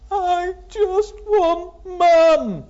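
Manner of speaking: high, loud, very slow tearful wail; crying child. Acted. Little girl crying to the carer for her mum. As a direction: sob it out, drawn right out.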